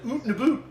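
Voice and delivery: "oot and a boot" with long oo vowels, quick; exaggerated Canadian pronunciation. Shown as a demonstration. Host guessing how Canadians say it, "oot and a boot" in oo vowels. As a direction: turn each ow into oo: "oot and a boot". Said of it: "how the heck do they say that?".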